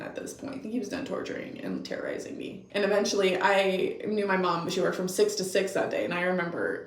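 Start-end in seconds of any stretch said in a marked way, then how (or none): 0.0-2.7 soft, fast whisper; a breathy trailing whisper. Real, not acted. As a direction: let it drop to a hush. Trails off in a hush.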